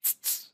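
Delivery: TSS as a sharp puff of air; voiceless tss, tongue-tip release; isolated T sound. Shown as a demonstration. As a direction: make the bare T sound twice. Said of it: "T sound".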